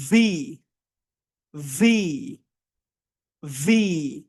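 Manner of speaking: long buzzing "ভি" with vibrating lip; drawn-out V. Shown as a demonstration. Teacher drills the class on the V sound, drawn out. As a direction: hold the v of "ভি" on teeth and lip. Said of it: "এবার আমরা বলবো".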